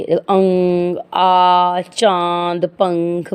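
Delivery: अंग, आ, चांद, पंख drawn out through mouth and nose; nasalised vowels. Shown as a demonstration. Teacher showing nose-and-mouth vowels, अंग, आ, चांद, पंख nasalised. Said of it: "मुख और नाक से".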